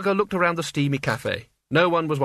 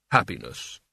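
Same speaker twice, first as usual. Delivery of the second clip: lower, hollow and wondering; puzzled Death; 6 semitones lower than usual. A narrator's reading. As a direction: try the word out like Death. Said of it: "puzzled at the sound of his own voice".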